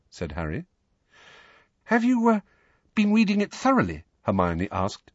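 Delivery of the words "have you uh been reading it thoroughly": very high, halting, anxious question; more anxiously. A narrator's reading. Hermione presses Harry on his reading, more anxiously. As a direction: hesitate midway, anxious about asking. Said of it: "still more anxiously".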